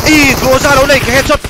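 loud, fast, high yelling; excited shout. Real, not acted. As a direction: shout the headshot call fast. Headshot call in game.

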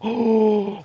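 high, drawn-in gasp of alarm; real gasp of dismay. Real, not acted. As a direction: suck in a startled breath. Sees dogs near open chicken pen.

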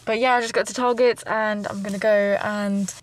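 muffled behind cloth mask; speaking with covered mouth; through a face mask. Real, not acted.

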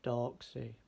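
plain reading voice

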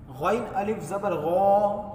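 slow, drawn-out spelling of the letters; recitation-style spelling; spelled out slowly. Shown as a demonstration. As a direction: sound out each letter for students. Tajweed teacher spells out letters.

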